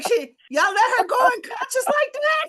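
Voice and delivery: high, loud laughing outrage; laughing shout. Retold or imitated. Speaker scolding the crew about Evelyn sleeping, laughing shout. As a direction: shout the question while cracking up. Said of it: "I was like".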